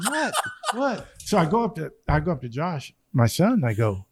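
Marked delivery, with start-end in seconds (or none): 0.0-1.1 high puzzled whats; baffled, asking around; high, bewildered what what; confused what. Retold or imitated. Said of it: "I'm like, going".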